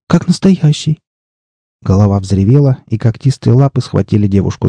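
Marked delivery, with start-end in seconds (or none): 0.1-1.0 put-on high-pitched girlish wonder; startled, high exclamation; amazed girl. A narrator's reading. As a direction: marvel in a raised, girlish voice. Startled girl sees monster's head.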